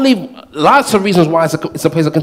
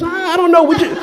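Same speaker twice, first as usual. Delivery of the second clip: much higher, laughing, shrugging nonchalance; casual mock God; 9 semitones higher, faster than usual. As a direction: play God as a noncommittal partner. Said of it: "And he says".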